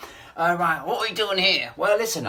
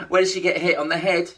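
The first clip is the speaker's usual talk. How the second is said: normal talking voice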